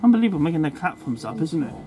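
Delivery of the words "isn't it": usual speaking voice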